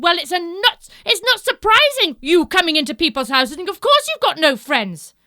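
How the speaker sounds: very loud high telling-off; loud high stern telling-off; scolding bears. Acted. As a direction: scold her like naughty child.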